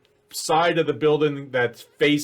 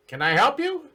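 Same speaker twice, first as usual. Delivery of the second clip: much higher little goblin voice; second goblin voice; 12 semitones higher than usual. Acted. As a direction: chirp it like a small goblin. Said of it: "you hear another voice say".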